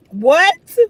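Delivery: a sharp baffled what; exasperated what. Real, not acted. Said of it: "exasperated rhetorical question".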